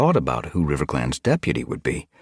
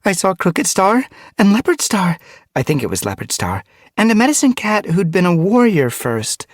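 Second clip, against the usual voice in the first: excited; 5 dB louder than usual. Acted. Excited telling of vision.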